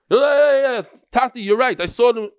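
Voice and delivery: high, loud, brash "yeah yeah Tati"; cheeky kid talking down to his father; mocking eager son. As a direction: parody a know-it-all boy butting in.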